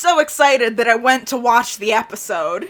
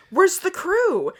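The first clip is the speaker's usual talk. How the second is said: high-pitched, slow, timid question; mock-frightened question; scared; 8 semitones higher, slower than usual. A narrator's reading. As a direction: ask it high like a frightened kid. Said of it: "because I'm scared".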